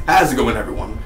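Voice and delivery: ordinary talk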